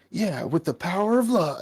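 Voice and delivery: high-pitched, cheesy hero proclamation; mock heroic. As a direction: proclaim it like a triumphant Power Ranger. Voices hero's victory line.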